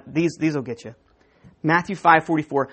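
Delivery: usual speaking voice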